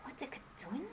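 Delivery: whispered anxious question; queen whispers her doubt to the king. Acted. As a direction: ask him under your breath.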